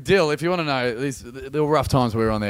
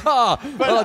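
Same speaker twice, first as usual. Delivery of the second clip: normal talking voice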